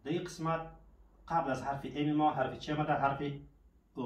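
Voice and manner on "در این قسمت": normal talking voice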